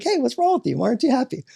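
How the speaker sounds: high-pitched self-parody; exaggerated, high voice for quizzing a child; mock adult questioning. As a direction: parody yourself pestering a kid. Mimicking himself questioning a child.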